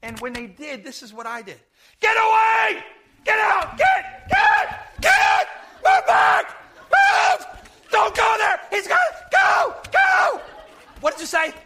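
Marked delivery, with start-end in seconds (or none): 2.0-11.5 shrill high yells warning people off; squealing panicked shouts; frantic screaming. Retold or imitated. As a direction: scream at the crowd to get back from the gunman. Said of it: "My voice was squealing just like that".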